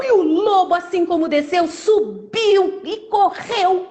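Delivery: high-pitched, excited narration; animated storyteller at the climax; excited storytelling. Acted. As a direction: stretch the action words high for drama. Narrates the wolf's escape.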